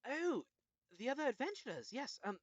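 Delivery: high, smiling gnome lady; freed gnome. Acted. GM plays the gnome.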